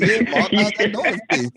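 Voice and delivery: mocking question through laughter; laughing taunt. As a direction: laugh while you taunt him. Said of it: "He said".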